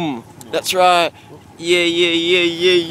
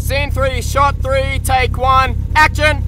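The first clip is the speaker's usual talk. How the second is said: director's call; 11 semitones higher, 4 dB louder, slower than usual. Real, not acted. Director calling the take.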